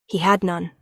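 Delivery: ordinary delivery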